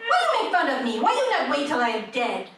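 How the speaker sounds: high, broken-English immigrant mother voice; mother's accent. Retold or imitated. Mother scolding comedian daughter, high accented whine. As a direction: play the complaining mom in her accent. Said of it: "And she said".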